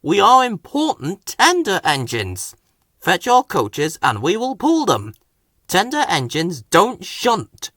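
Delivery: high, huffy proud engine. A narrator's reading. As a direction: huff it out, full of pride. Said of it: "huffed Henry".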